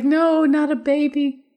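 high, slow disbelieving no; pleading with the film, not a baby; drawn-out high protest at the screen; mock dismay. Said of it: "I was like".

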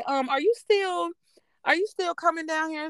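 a hint of a polite caseworker asking carefully; child support lady.

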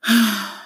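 heavy tired sigh; weary sigh. Acted. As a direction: sigh with worry. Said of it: "He puts his hands on his hips and sighs".

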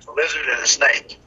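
ordinary talk, nothing marked

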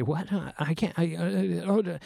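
acted caught off guard; flustered stammer. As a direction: stammer out a half-formed excuse. Voicing man flustered by sudden request.

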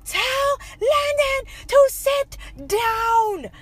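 high, drawn-out mock wail; acting out a frantic scream down the phone; retold screaming. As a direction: stretch it slowly into a high-pitched, desperate yell. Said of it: "was screaming at him too".

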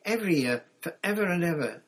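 put-on high, loud, quick child's promise; eager child. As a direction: vow it like an excited youngster.

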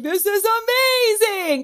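high, drawn-out wonder; excited. Retold or imitated. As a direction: gush as your younger self discovering it. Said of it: "And I was like".

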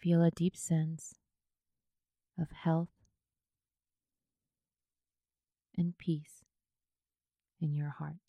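unhurried yoga nidra guidance, leaving space after each phrase; guided meditation. Acted.

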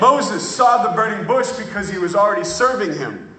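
high, loud, fervent preaching; preaching at full force. Acted. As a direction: carry the room with rising fervour.

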